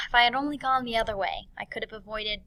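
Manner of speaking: regretful sigh. Acted. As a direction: act out wistful regret. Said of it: "a sigh that is bad".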